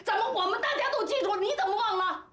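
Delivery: high-pitched, heated reproach; indignant scolding. Acted. Friend throws the husband's old vow back at him, indignant scolding.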